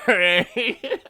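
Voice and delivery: high laughing question. Real, not acted. Laughs at his own point.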